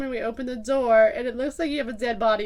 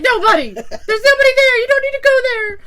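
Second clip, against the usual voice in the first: high, loud laughing plea; laughing panic; 12 semitones higher, 11 dB louder than usual. Retold or imitated. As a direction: laugh while desperately steering her away. Hurriedly steering drunk friend away.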